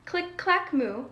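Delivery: ordinary delivery, nothing marked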